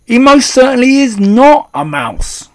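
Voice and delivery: high, very loud denial; outraged shout. A narrator's reading. Father contradicting the grandmother, shouting indignantly. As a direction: shout it emphatically, full of indignation. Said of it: "shouted Mister Jenkins".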